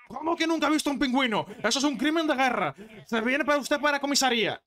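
high-pitched mock indignation; high, irate officer from a joke; outraged policeman. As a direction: arrest someone in exaggerated disbelief. Policeman outraged, arrests man.

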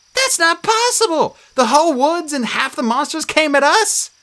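losing her cool; panicked. A narrator's reading. Annabeth facing the scorpions, panicked.

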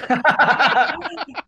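high, loud laughing Yeah; laughing yes. Retold or imitated. Toy maker admitting it's vac metal, laughing. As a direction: blurt it out with a knowing laugh. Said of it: "he was like".

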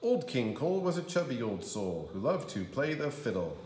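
ordinary delivery, nothing marked

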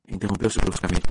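plain reading voice

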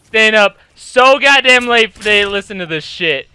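normal talking voice

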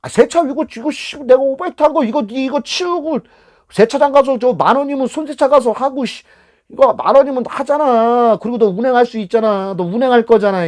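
high, loud indignant complaint; acted-out belligerent customer; contemptuous passenger. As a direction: talk down to the driver, loudly. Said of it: "택시기사를 알로 보는 거지".

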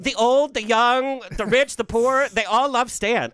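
loud, high, hyped-up list; excited. Acted. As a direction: build it up like a fan announcement. Hyping up Stan's fans.

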